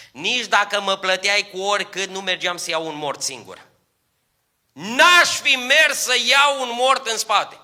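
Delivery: preacher thundering to the congregation about tears; preaching at full force. Acted. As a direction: lift the church with a ringing sermon. Preacher exclaims.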